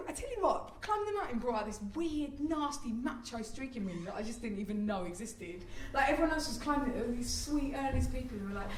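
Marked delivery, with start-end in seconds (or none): none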